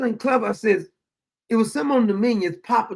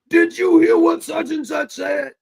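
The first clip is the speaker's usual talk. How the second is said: high, loud breathless-news imitation; mock excited gossip; 8 semitones higher, 6 dB louder than usual. Imitating people passing on tweets, mock excited. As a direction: mimic someone shouting the latest gossip.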